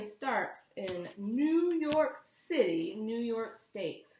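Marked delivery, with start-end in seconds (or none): none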